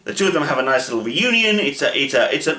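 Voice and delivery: ordinary talk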